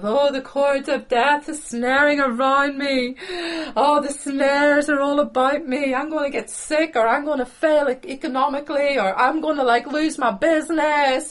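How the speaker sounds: high, loud self-pitying whinge; whiny pity party. Acted. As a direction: wail it like a hammed-up worrier. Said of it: "sit in a negative pity party".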